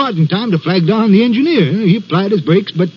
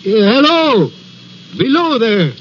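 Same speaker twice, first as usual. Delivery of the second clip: higher-pitched hail, no real shout; imitated calling cry; 4 semitones higher than usual. Signalman recalling a voice in the night, calling out. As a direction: call out as if from far above. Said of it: "I heard a voice cry".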